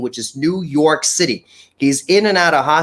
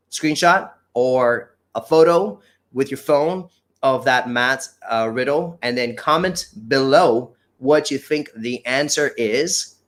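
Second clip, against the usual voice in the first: normal talking voice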